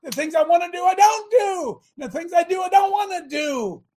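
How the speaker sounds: shouting Paul's despair from the pulpit; anguished cry. Acted. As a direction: cry out as a man at war with himself. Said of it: "The Apostle Paul said".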